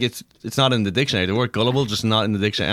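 rattled off fast and low; fast insistent imitation. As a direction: hurry through it like she's sure. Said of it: "She's like".

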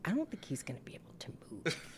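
fast, voiceless hushed worry; whispering. Retold or imitated. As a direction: whisper it quickly, worried. Son quoted whispering.

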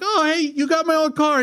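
high, eager imitated kid; proud excitement. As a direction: pitch way up like a proud former owner. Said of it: "the guy's like".

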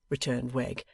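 plain reading voice

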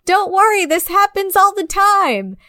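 imitating reassuring parent. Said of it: "you know, like".

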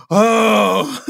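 as if a hero just hugged you; stunned gasp. Retold or imitated. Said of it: "And I'm like".

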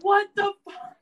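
loud, very high mock outburst; exasperated stepdad. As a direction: blow up in disbelief, imitating a fed-up parent. Stepdad's exasperated reaction, retold.

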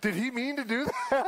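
high, laughing in disbelief; imitating the wife, giggling through the question. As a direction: ask it while cracking up. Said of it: "She's like".

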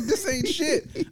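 very high, drawn-out protest; high-pitched, stretched-out dismissal; incredulous retort. Retold or imitated. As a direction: scoff in a high, slow squeal of disbelief. Retold incredulous retort to cousin.

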